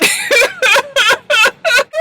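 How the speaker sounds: high shrieking laugh, close to tears; can't hold it, screech with laughter. Real, not acted. Host cracking up at the story.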